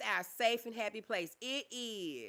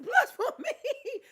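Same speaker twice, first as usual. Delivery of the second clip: laughing through the words; 10 dB louder, slower than usual. Real, not acted. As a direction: giggle it out, loud. Laughs about paranormal light.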